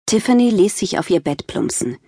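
ordinary talk

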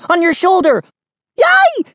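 very high alarmed squeal; screamed. A narrator's reading. Kid tricking sister about a spider, screaming. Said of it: "I screamed".

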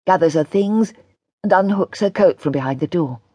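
plain reading voice

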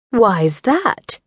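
a hint of over-my-dead-body defiance; a touch of upper-hand coyness; holding all the cards. A narrator's reading. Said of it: "in an I'm holding all the cards".

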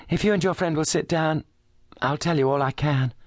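a shaken noblewoman holding together; refined distressed lady. A narrator's reading. Lady Brackenstall voiced in story.

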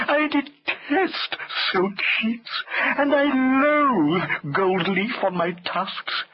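very slow, plaintive elephant voice; ponderous, self-pitying wail; mournful elephant. A narrator's reading. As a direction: lament every word at length. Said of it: "said Hamlet mournfully".

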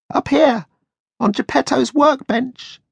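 very high tiny voice calling up; piping from the workbench; cricket voice. A narrator's reading. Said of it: "the voice said".

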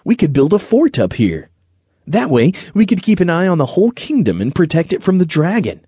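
light up with a new idea, voice lifting high; brightened. A narrator's reading. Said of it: "Then he brightened".

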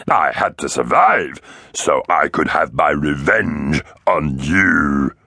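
slow, gurgling vengeful rasp; rasping raptor. A narrator's reading. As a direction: drag out the vow of revenge. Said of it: "rasped the Oviraptor".

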